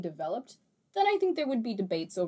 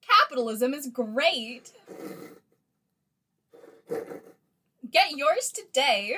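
mock ad voice; 6 semitones higher, 6 dB louder, slower than usual. Mock advert slogan.

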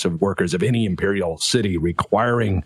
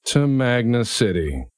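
slow, weary announcer drone; bored PA announcement; much slower than usual. Acted. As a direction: drag it out like a tired recording. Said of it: "he's had to say this every thirty minutes".

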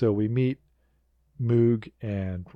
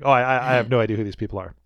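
fast, louder mock protest of ignorance; lying denial; 2 semitones higher, 6 dB louder, much faster than usual. Caught liar disowning his companions, hurried feigned innocence. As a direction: play a liar disowning the others. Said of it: "just a liar".

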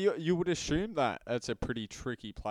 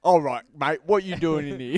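louder mock Cockney accent for a queen; 4 semitones higher, 8 dB louder than usual. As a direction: play the Queen as a loud London Cockney. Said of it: "did she talk real, like, Cockney".

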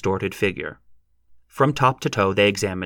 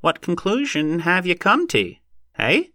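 put-on high, dry old horse trader's voice; tinder-dry, impatient heckler; impatient demand; 10 semitones higher than usual. A narrator's reading. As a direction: press for an answer in a high pitch. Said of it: "demanded an impatient voice".

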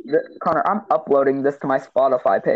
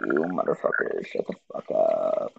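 mimicked low menace; 8 dB quieter than usual. Host throwing friend's curse back, mimicked deep quiet voice.

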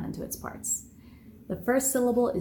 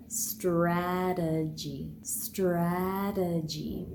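very slow "strategy", stress on the first syllable, tapped t; slow and careful; much slower than usual. Shown as a demonstration. Teacher modelling the whole word slowly for students. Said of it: "So altogether".

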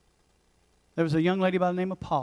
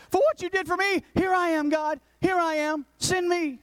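high, fervent, ringing call; eager offering; 10 semitones higher than usual. Retold or imitated. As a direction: lift it up, eager to be sent. Isaiah eagerly answering God.

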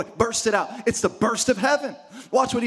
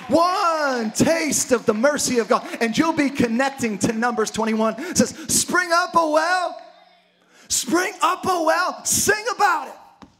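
preaching at full force; 5 semitones higher than usual. Acted. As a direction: lift the congregation, shouting the promise.

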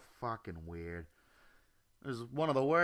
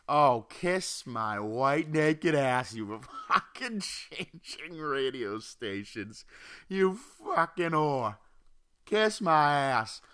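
slow, louder mock cursing at her; angry rant; 3 semitones higher, 4 dB louder, much slower than usual. Telling off the woman who rear-ended him, slow and louder. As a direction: drag out the insults with contempt.

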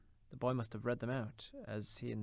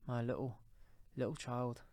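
halting, restrained grief; grieving cry; 2 semitones higher than usual. A narrator's reading. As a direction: falter between the words, holding back tears. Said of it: "cried Bob".

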